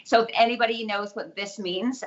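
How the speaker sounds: ordinary talk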